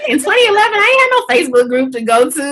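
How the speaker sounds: a rush of excited indignation; excited outburst. Real, not acted. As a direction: throw it out loud and quick with disbelief. Excited emphasis about the past.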